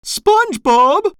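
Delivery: very high-pitched scolding call; Missus Puff shouting. A narrator's reading. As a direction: call out sharply like a cross mother. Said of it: "she shouted".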